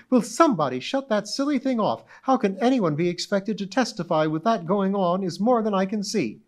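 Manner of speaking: high, indignant demand over the buzzer; angry. Acted. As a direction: snap at the courtroom in high outrage. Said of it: "said angrily".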